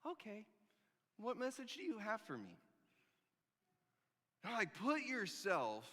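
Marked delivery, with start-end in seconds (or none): 0.1-2.6 much softer, cautious question; timid reply. Acted. Shepherd answering the angel, soft and timid. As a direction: ask meekly, still shaken.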